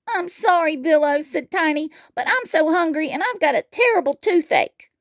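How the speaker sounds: high, sorry little voice; lightly voiced whining youngster; apologetic little dinosaur. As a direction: plead with a friend, high-pitched.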